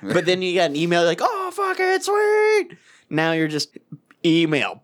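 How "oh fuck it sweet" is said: high, excited exclamation. Retold or imitated. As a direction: blurt it out thrilled. Excited over an email.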